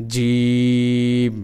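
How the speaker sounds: জিম stretched very slow and held; teacher drilling jim slowly; drawn-out jim. Shown as a demonstration. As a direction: hold জিম long like a teaching model. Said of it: "বর্গীয় জর মতো করে জিম".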